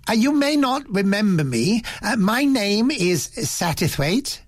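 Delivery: lightly voiced polite old gentleman; Satterthwaite voiced.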